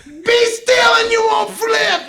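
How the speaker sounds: very high, slow screamed order; mock screaming. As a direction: imitate a frantic pusher shrieking a warning. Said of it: "she's, like, screaming at people".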